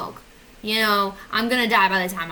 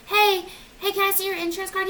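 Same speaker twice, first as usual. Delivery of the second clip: high-pitched front-desk voice; receptionist; 9 semitones higher than usual. Acted. Receptionist asking a patient for the card, chirpy.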